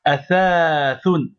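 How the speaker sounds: athathoun said very slowly with the fatha held; long fatha demo. Shown as a demonstration. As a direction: athathoun stretched out so the long a is heard. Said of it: "il faut prolonger le fatha". (athathoun, en prolongeant la fatha)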